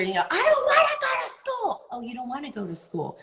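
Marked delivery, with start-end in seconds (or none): 0.3-1.8 put-on much higher shouted kid whine; whiny kid. 1.9-3.2 much softer, quick calm echo; calm validating parent. Retold or imitated. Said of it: "as soon as you validate".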